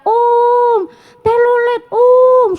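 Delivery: put-on high, slow child-like yell of om telolet om; high drawn-out chant at the bus; calling out. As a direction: shout to the passing bus like an excited kid. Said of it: "sambil teriak".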